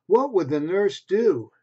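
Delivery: ordinary delivery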